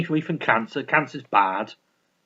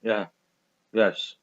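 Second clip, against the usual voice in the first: ordinary delivery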